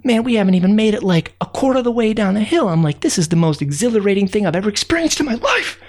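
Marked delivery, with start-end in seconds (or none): 3.0-5.9 exhilarated, fast, breaking into a laugh; laughing thrill. Acted. Narrator thrilled watching the ants, laughing. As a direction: gush it and laugh.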